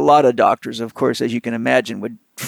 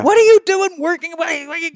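furious yell; 14 semitones higher than usual. Retold or imitated. Surgeon chewing out the therapist on the phone, furious. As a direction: tear into him like an outraged surgeon. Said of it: "He was yelling so loud".

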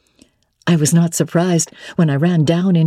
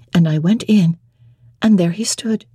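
usual speaking voice

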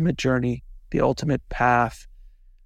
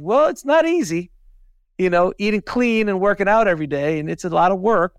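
high, louder mock-modest brag; boastful reply; 5 semitones higher, 4 dB louder than usual. Fit classmate answers admiring friend, mock modest. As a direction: play the smug fit friend.

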